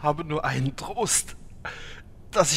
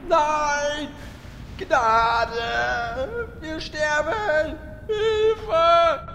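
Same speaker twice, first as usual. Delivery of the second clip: panicked screams; 6 semitones higher, much slower than usual. Acted. Victims screaming for mercy.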